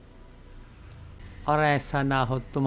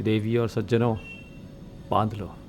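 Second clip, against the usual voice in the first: lightly imitating a deep baritone; a hint of Bachchan's grand voice; Amitabh Bachchan; 3 semitones lower than usual. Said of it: "Bachchan साहब को या उनकी आवाज़ को लिया जाए". (बच्चन साहब की आवाज़ की हल्की नकल)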